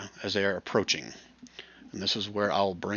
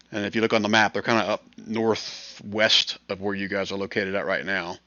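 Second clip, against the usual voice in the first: usual speaking voice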